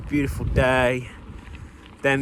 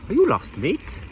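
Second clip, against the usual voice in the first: high-pitched mock Welsh lilt; bad Welsh accent; 8 semitones higher than usual. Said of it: "he said it in a Welsh accent".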